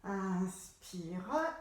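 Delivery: slow, stretched word; drawn-out breathing cue. Shown as a demonstration. Instructor cueing an inhale, slow and stretched. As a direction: draw it out over an in-breath. Coach modelling a long inhale.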